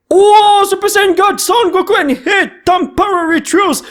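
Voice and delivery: excited shout. Real, not acted. Thrilled by a rare card.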